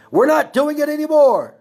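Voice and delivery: loud, high-pitched outburst; heated insistence. Real, not acted. Heated political rant to live viewers.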